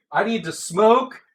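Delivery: plain reading voice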